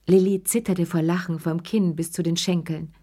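usual speaking voice

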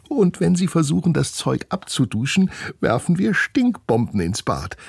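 put-on high, gleeful girl's voice; scheming girl. Steffi adding to the prank plan, gleefully scheming. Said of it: "ergänzt Steffi und reibt sich die Hände".

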